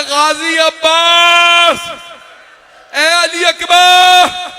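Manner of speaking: very high, slow, drawn-out shout; crying out for help. Retold or imitated. Imam calling his fallen companions, high wailing cry. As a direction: wail each call as if across a battlefield. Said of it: "मेरे मौला चीख चीख के कह रहे".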